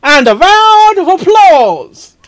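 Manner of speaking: rally an imaginary crowd; hammed-up cheer. Calling for applause.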